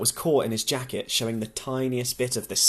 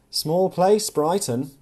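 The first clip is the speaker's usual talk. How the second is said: louder, higher, cheery quip; jovial; 6 semitones higher, 5 dB louder, slower than usual. Acted. The cafe woman chiming in jovially to the pair, cheery. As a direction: chirp it out cheerfully. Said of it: "said jovially".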